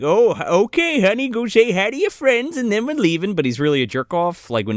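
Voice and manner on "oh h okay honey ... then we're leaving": higher, sugary put-on dad voice; fake-nice dad. Dad performs for his daughter's friends, mock sweet. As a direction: play up the phoney niceness. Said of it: "the dad plays it up like".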